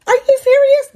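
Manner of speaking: shocked disbelief. Retold or imitated. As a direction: squeal it in outrage. Shocked reaction in the story.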